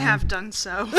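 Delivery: ordinary talk, nothing marked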